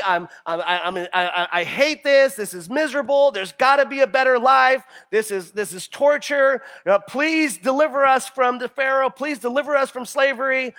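a hint of a loud, pleading cry to God; desperate plea. Slaves begging God for rescue.

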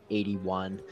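flat, staring him in the eyes; deadpan. Retold or imitated. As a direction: Kobe to Jalen Rose, deadpan. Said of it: "straight face".

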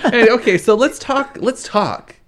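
perky fake segue back into the show; podcast host voice. Host imitating their own cheesy segue into the story, chirpy. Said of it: "be like".

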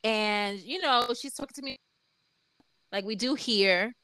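normal talking voice

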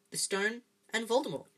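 ordinary delivery, nothing marked

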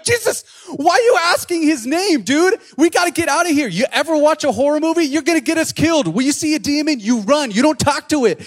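high-pitched, hammed-up alarm; panicked disciple. Disciple begging Jesus to flee the demon, high panicked protest. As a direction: plead in a high, frantic rush. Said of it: "And you're like".

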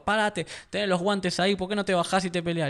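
high, fast mocking dare; provoking driver. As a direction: goad him into getting off the bike. Driver daring him to fight. (conductor provocador imitado, agudo y rápido)

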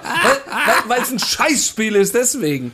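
high loud laughing outburst; laughing rant. Real, not acted. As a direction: shout it while laughing. Ranting back, laughing.

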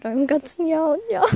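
giggling at herself; laughing. Real, not acted. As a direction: laugh through the words. Laughing at her own filler words.

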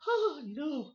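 high gasped "oh, no"; dread. Retold or imitated. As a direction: re-enact sudden horrified realization. Retelling dread felt at the door.

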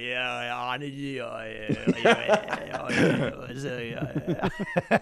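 a lazy, laughing rapper's yeah-yeah; rapper dude. Retold or imitated. Comparing a hockey player to a rapper, laughing rapper impression. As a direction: mumble the yeahs like a chill rapper. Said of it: "rapper dude being like".

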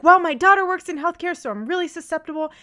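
worked-up, self-important complaint; retold angry retort. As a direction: imitate an angry shopper justifying herself loudly. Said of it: "You seem extremely angry right now".

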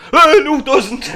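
high, loud sudden revulsion; loud disgusted reversal. Real, not acted. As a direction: snap back loud and high, grossed out.

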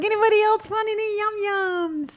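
high-pitched mock call to the room; playful calling out. Customer offering yum yums to everyone, high playful call.

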